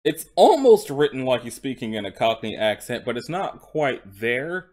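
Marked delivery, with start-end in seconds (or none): 0.0-3.0 "almost" punched much louder; stress on almost. Real, not acted. Reader amending a complaint, heavy stress on "almost". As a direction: hit the word "almost" hard to correct yourself. Corrects own remark on the script.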